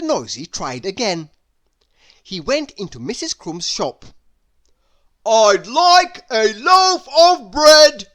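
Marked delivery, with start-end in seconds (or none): 5.2-8.1 loud, high, slow bellow; booming shout. A narrator's reading. Mister Noisy bellowing at the baker, loud and slow. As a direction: boom it across the shop. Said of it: "he boomed".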